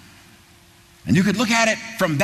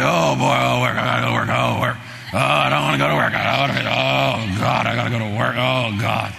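lower, groaning whine about work; mock reluctant worker; 7 semitones lower than usual. Preacher mocking listeners who dread work, low groaning whine. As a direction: imitate a worker dragging feet.

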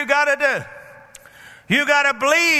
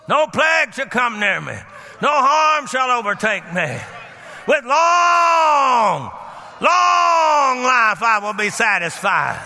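forceful declaration; slower than usual. Shown as a demonstration. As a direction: proclaim it boldly to the devil. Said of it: "I'm forceful with it".

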